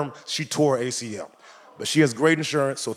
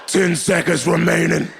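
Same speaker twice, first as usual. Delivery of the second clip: countdown announcer; 2 semitones higher than usual. Pretend. Countdown voice for the bladder.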